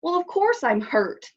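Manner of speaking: grumpy giant snapping at the mother; snapped. A narrator's reading. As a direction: bite the words off. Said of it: "the monster snapped".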